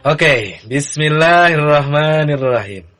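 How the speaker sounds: ordinary talk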